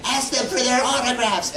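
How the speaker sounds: put-on high, excited kid voice with a laugh; inner child squeal. Inner child begging for autographs, high excited squeal. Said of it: "the child in me was, like, screaming".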